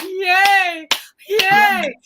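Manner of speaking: high, loud sarcastic crowd cheer; mock cheering. Mocking royal fans on the sidelines, mock cheering. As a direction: imitate an overexcited spectator's drawn-out cheer.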